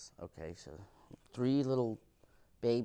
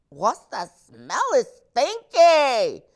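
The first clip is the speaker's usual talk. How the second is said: much higher, loud, slow stinky; disgusted mom; 13 semitones higher, 16 dB louder, slower than usual. Retold or imitated. Mom sniffing the kids, high mock disgust. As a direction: drag out stinky like a grossed-out mom. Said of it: "She was like".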